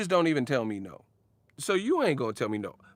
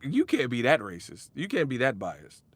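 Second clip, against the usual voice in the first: ordinary talk, nothing marked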